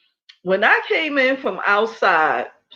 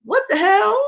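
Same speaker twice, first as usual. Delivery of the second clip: incredulous; 9 semitones higher than usual. Retold or imitated. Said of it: "I'm like".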